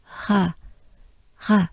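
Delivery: "Ha" as a pure outward breath; breathy exhale. Shown as a demonstration. Teacher breathing out "Ha" for the French R. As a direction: breathe out "Ha" with no voice behind it. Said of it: "And you exhale".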